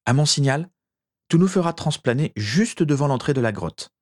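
mildly urgent command; with authority. A narrator's reading. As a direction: give instructions like someone taking charge. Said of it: "dit Régulus avec autorité".

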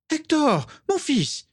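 very high motherly cry; high emotional exclamation. A narrator's reading. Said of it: "s'écria Ursula".

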